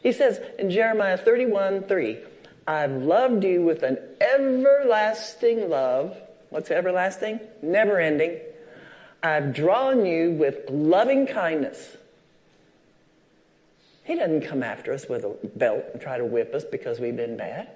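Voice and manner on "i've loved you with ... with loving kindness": scripture reading. Acted. Reads scripture from the pulpit.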